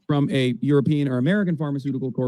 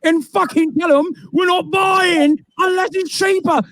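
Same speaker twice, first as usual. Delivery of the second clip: shouted mock British accent; 10 semitones higher than usual. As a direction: bark it as a British official. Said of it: "they're speaking in English in the U K".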